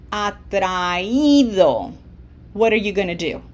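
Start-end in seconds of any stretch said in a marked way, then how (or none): 0.1-1.9 atraído said slowly with a separate i, a-tra-Í-do; clear Spanish word. Shown as a demonstration. Teacher models atraído for students, slow and clear. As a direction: draw out atraído, stressing the í. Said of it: "If I want this to sound".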